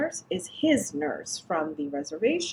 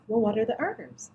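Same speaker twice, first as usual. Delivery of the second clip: normal talking voice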